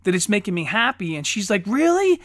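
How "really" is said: high, loud imitation of excited child; amazed little girl. Daughter learning of other movies, imitated high amazement. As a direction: ask it in mock childlike wonder.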